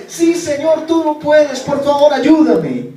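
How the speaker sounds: very high, loud desperate begging; the climber begs the Lord to save him, frantic; frantic plea. Acted. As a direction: throw everything into the last plea. Man pleading with God.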